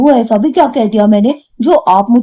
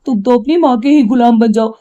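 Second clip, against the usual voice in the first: ordinary talk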